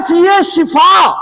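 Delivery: شفا with zir on the shin, shouted high and slow; emphatic shifa. Shown as a demonstration. Preacher stressing the correct vowel in شفا, loud and slow. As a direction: call out شفا as shi-faa, loud and drawn out. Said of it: "شین پر کیا ہے؟ زیر ہے".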